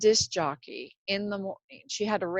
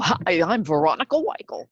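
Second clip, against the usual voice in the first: louder, polished radio DJ greeting; Veronica Weichel voice; 8 dB louder than usual. Retold or imitated. Fan imitating a beloved morning DJ's on-air greeting, polished and bright. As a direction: greet listeners like a smooth morning DJ. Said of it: "this fabulous Veronica Weichel, voice".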